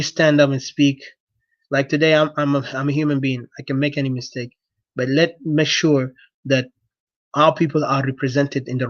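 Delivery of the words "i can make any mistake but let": ordinary delivery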